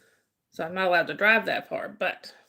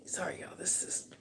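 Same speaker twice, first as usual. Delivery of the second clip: whisper; 14 dB quieter than usual. Real, not acted. Hushed aside while on stream.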